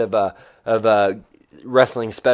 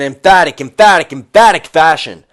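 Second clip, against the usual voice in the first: punching emphatic louder each time; overexcited repetition; 3 semitones higher, 8 dB louder than usual. Real, not acted. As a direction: hammer the word emphatic with bursting energy. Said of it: "Sorry. ... Got a lot of energy".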